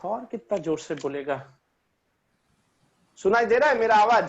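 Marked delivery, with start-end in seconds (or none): 3.2-4.3 louder, pushing the voice out for the class; speaking up. Real, not acted. Teacher asked by a student to speak louder, pushing his voice. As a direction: project it so the back row hears. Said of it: "आप थोड़ा जोर से बोलिए ना".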